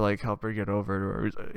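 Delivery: usual speaking voice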